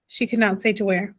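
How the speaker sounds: usual speaking voice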